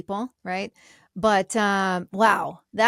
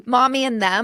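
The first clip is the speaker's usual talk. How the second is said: laughing; 3 semitones higher, 5 dB louder than usual. Real, not acted. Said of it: "laughing repeat".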